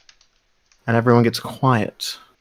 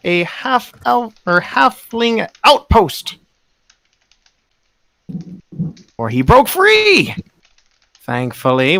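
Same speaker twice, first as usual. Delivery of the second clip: booming preacher; 7 semitones higher than usual. Acted. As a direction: announce it grandly to the whole square. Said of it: "his voice booms".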